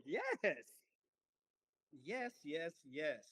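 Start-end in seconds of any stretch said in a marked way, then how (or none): none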